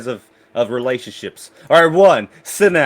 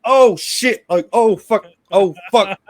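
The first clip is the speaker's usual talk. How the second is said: panicked higher yelps, caught in the act; caught off guard; 4 semitones higher, 3 dB louder, slower than usual. Retold or imitated. Guy caught with his bandmate, flustered mock panic. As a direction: blurt it out like he's just been walked in on. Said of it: "He's like".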